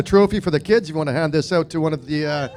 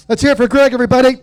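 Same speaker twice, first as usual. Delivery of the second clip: crowd hype; 6 semitones higher, 4 dB louder than usual. Real, not acted. Announcer rallies crowd.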